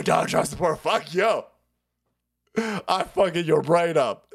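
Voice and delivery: normal talking voice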